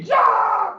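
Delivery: high, loud acted panic scream; crowd scream. Everybody screaming after the killing, high panicked yell. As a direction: scream out like a terrified onlooker. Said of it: "Everybody starts screaming".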